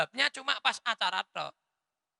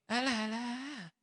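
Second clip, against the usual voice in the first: mocking scoff; 5 semitones higher, 7 dB quieter, slower than usual. Mengejek penulisan viral.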